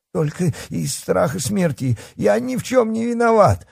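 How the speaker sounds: high, whining protest of innocence; frantic self-defence. A narrator's reading. Coward pleads his innocence.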